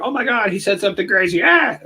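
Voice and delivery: high-pitched mock freak-out ending in a scream; fake over-the-top reactor. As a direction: mimic a hysterical fake reaction, squeal at the end. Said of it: "fake reactors ripping off their headphones".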